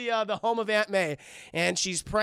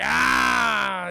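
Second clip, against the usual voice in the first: goblin growl; 5 dB louder than usual. Retold or imitated.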